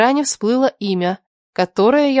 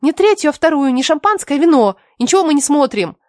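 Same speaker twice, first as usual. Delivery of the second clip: high, insistent denials; raised-pitch, flustered contradiction; indignant correction; 6 semitones higher than usual. A narrator's reading. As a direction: correct each point emphatically, pitched up. Friend indignantly corrects the tipsy claim.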